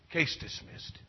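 breathy hushed ruling, nearly voiceless; hushed verdict. Retold or imitated. Judge quietly ending the case, in a hush. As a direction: let the verdict fall softly.

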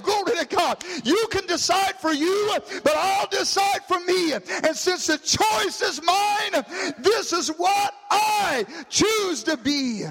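impassioned preaching climbing to a high shout; full-force preaching. Real, not acted. Preacher declaring the choice is his own, shouted preaching. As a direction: hammer out each declaration, stretching the last ones.

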